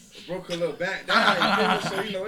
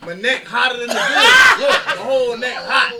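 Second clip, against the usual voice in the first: loud, slow laughing yell; laughing shout; 7 semitones higher, 14 dB louder than usual. Retold or imitated. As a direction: crack up while shouting it. Laughing shout about wet neck.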